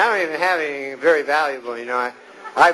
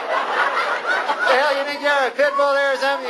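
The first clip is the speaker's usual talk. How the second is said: mock outrage; 7 semitones higher than usual. Yelling at wiener dog.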